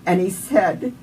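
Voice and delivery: ordinary delivery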